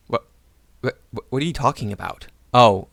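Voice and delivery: usual speaking voice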